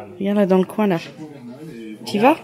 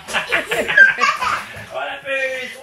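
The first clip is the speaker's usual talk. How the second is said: squealing giggles while tickled; helpless ticklish giggling; being tickled; 4 semitones higher than usual. Real, not acted. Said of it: "les gros guilis".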